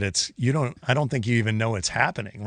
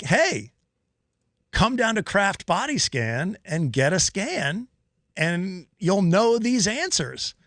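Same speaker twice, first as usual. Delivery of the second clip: higher, slow quoted invitation; retold invitation; 7 semitones higher, slower than usual. Host quoting the guest's offer, high drawn-out retelling. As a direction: draw it out high, imitating a friendly offer.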